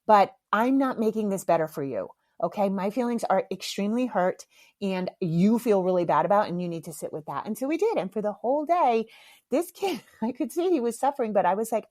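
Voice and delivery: ordinary talk, nothing marked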